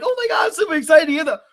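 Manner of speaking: high, fast imitation of a gushing fan; mock excited viewer. YouTuber mimicking someone clicking a video, mock excited. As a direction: gush it quickly in a put-on high voice. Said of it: "someone gonna click on the video like".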